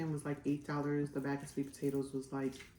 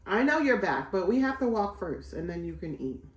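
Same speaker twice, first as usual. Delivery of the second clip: louder, lifted voice to the dog; talking to a pet; 3 semitones higher, 7 dB louder than usual. Real, not acted. Owner telling her dog to walk before eating, raised voice. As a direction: call over to an eager pet.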